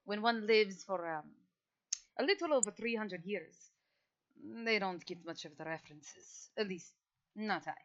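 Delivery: a hint of a weary ancient noble; old vampire. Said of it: "shrugs to her and goes".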